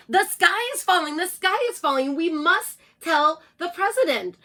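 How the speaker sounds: high, loud panicky clucking; clucking hen voice. Acted. Chicken Licken ignores the narrator's warning, high clucking cry. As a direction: cluck the alarm over him. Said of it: "clucked Chicken Licken".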